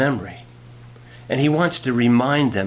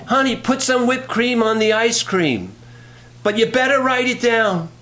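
high-pitched calling to another room; acted yell, more pitch than volume; mock wifely yell; 10 semitones higher than usual. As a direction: call out high, like a wife to the kitchen. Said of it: "she yelled".

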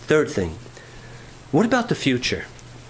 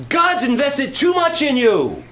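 firm loving insistence; 9 semitones higher, 4 dB louder than usual. Retold or imitated. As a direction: hold him tight and refuse to let him go. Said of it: "she threw her arms around me and she said".

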